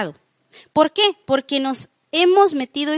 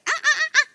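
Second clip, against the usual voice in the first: high squeaky monkey ah ah ah; monkey chatter; 14 semitones higher, faster than usual. Retold or imitated. Preacher imitating the monkeys that raised Tarzan, high monkey chatter. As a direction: chatter like a little monkey from the jungle. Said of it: "puros monitos".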